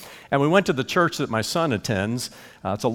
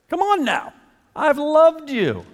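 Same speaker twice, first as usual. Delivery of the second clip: much higher, slow, pleading; high pleading voice of God; pleading divine voice; 13 semitones higher, 4 dB louder, slower than usual. Acted.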